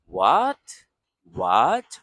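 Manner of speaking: What? rising like a surprised question; 第二声像问句. Shown as a demonstration. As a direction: ask What? with a lift, like tone two. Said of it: "Xue sheng is like".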